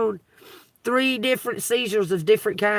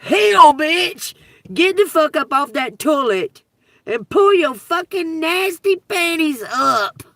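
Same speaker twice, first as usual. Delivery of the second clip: hammed-up healer shouting, much higher and louder; mock preacher yell; 9 semitones higher, 9 dB louder than usual. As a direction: belt it like a fake evangelist commanding a miracle. Mock evangelist yelling a healing.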